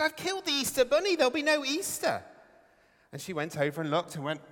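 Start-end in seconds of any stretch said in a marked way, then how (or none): 0.0-2.2 high, fast hammed-up wail; mock crying driver. Driver tells the lady he killed the bunny, in a mock sob. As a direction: play the driver sobbing over the bunny, half-joking. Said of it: "He started to cry".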